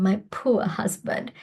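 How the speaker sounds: normal talking voice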